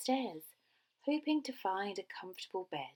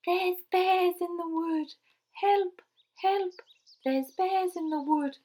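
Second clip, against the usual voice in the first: high, loud cry for help; Goldilocks crying out; 11 semitones higher, 10 dB louder than usual. A narrator's reading. Goldilocks fleeing the three bears, high cry for help. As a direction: shout the alarm in a high voice. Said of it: "heard Goldilocks crying out".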